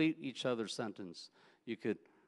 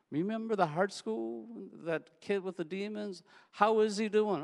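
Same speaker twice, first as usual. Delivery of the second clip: eager reminiscing; 9 semitones higher than usual. Retold or imitated.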